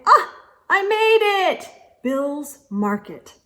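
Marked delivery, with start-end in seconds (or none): 0.1-1.7 high, loud triumphant cry. A narrator's reading. Boy reaching the store at last, triumphant. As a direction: announce the arrival with triumph.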